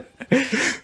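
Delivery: high-pitched laugh; high burst of laughter. Real, not acted. Laughing at his own impression of the worker, high laugh.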